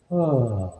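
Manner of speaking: long falling mock sigh; imitated sigh. Retelling a man's sigh heard by the ear, imitated. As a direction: let out a man's heavy sigh. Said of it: "I just heard a man sigh".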